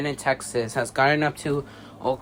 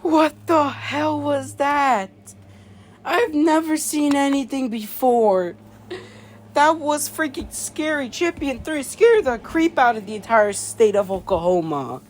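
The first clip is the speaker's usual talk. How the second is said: shaken and scared; 11 semitones higher, 12 dB louder than usual. Acted. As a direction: speak like someone still in shock. Shaken announcer after the villain.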